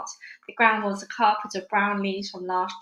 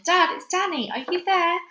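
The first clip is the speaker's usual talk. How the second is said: high, raised searching call; shout; 9 semitones higher, 4 dB louder than usual. A narrator's reading. Child calling lost father in the wood, shouting. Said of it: "I shouted".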